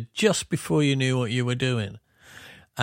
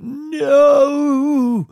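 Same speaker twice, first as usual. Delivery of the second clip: high, loud, drawn-out no; mock wail of protest; 10 dB louder than usual. Bandmates protesting the tidied-up version, mock wailing. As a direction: cry out in exaggerated dismay, holding the vowel.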